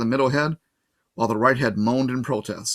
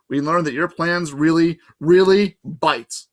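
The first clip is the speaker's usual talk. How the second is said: scolding friend; 5 semitones higher, 5 dB louder, slower than usual. Acted. Grover scolding Percy.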